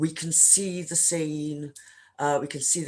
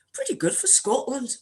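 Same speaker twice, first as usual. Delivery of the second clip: put-on "Pretty good for Scotland" in a Scottish accent, pitched higher; 9 semitones higher than usual. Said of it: "imagine a much better Scottish accent".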